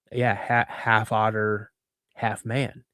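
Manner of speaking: lightly voiced dad, low and soft; earnest dad. Dad explains the creature to laughing son, earnest quiet answer.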